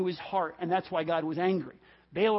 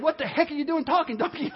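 high, quick, astonished question; flabbergasted at a talking animal; incredulous; 6 semitones higher than usual. Acted. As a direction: blurt it out in mock disbelief. Acting astonished at talking donkey.

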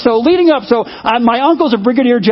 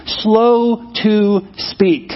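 very slow emphasis; much slower than usual. Shown as a demonstration. Preacher repeating the verse to the congregation, drawn out very slowly. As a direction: stretch "Slow to speak" out word by word.

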